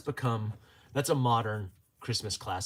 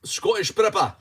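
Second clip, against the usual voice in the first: put-on high, loud Scottish accent; 9 semitones higher, 9 dB louder than usual. Calling to a viewer by name, loud Scottish accent. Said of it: "practicing my Scottish accent".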